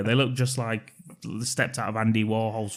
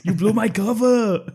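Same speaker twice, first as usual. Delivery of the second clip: hammed-up movie line; 6 semitones higher, 4 dB louder than usual. Acting out film scene quote.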